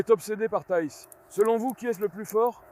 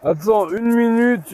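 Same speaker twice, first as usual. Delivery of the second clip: louder and slow, calling out to the animal; 8 dB louder than usual. Real, not acted. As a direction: hold it back with a slow, loud call. (appel fort et lent à l'animal)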